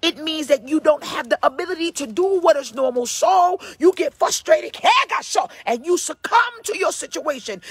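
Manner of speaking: forceful, driving sermon voice; full-throated church exhortation; fervent preaching. Real, not acted. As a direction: preach it hard, punching and stretching key words.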